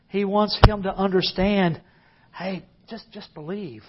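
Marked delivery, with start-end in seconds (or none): 2.3-3.8 very soft, gentle coaxing; Jesus gently telling the grieving father to believe, softly; soft urging. Retold or imitated. Hushed, tender encouragement.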